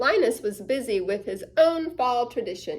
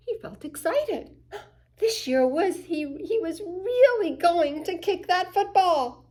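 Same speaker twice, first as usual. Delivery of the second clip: excited; 7 semitones higher than usual. A narrator's reading. Narrator voicing excited Charlie.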